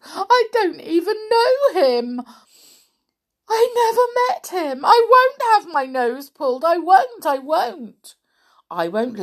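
very high, loud panicky denial; frightened wail. A narrator's reading. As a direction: wail in childish terror. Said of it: "he wailed".

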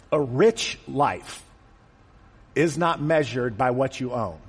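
each word set apart, very slow and deliberate; slow emphatic rephrase. Shown as a demonstration. Preacher slowly rewording Jesus' words for the congregation. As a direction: lay out the new phrase slowly for weight. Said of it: "Could he have said".

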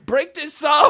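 high, loud mock-desperate cry; pleading yell. Boy grabs the lunch lady for rescue, high pleading yell. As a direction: yell for help like a coward. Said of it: "I'm such a punk. I start grabbing the lunch lady".

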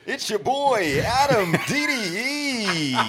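slow, high DJ intro drawl; radio intro voice. Pretend. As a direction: stretch it out like a radio host. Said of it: "that might be the new intro right there".

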